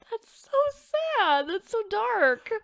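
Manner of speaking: dismayed. Real, not acted. Reacting to the tragic legend.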